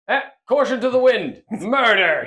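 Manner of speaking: loud, high, playful battle cry; reckless war machine. Acted. Player voicing Magira running up with claw, mock battle cry. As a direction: hurl it out as a gung-ho charger. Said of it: "He's like".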